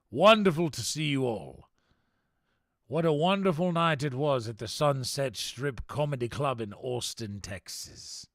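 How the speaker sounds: theatrical mock-aristocratic accent; hammed-up posh accent. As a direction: gush over the viewers in a mock posh accent. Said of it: "in this accent".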